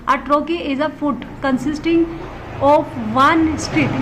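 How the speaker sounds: very slow, word-by-word definition reading; reading aloud. Acted. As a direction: spell the definition out slowly. Reads the textbook definition aloud. (बहुत धीमी गति से परिभाषा पढ़ना)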